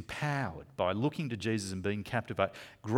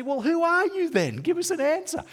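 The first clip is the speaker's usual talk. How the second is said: much higher, loud impatient challenge; exasperated demand; 6 dB louder than usual. Acted. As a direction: push him hard, fed up. Questioners losing patience with John.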